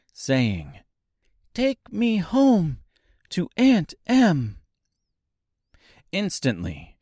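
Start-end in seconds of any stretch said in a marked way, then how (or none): 1.5-4.6 slow, high, earnest wish; little girl voice. A narrator's reading. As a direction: say it like a heartfelt wish. Dorothy commands shoes.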